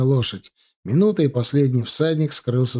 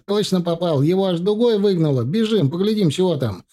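high, excited exclaiming; delighted cry; 6 semitones higher than usual. A narrator's reading. Soldier calling comrades to go and look, excited and delighted. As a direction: bubble over with the news, pitched up. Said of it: "закричал в восторге Макар".

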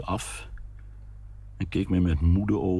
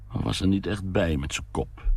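ordinary delivery, nothing marked

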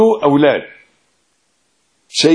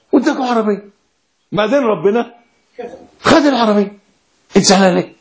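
raised, scolding lecture to his daughter; father chiding his crying daughter, higher, mock-scolding; 6 semitones higher than usual. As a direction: tell her off with mock disbelief.

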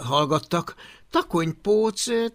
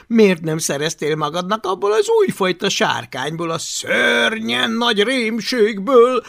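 loud, higher shouting thug; brutish bully shouting; 6 semitones higher, 8 dB louder than usual. Acted. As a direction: bellow the taunts. Viogott Villámököl hangja. (kiabáló, nyers vagány)

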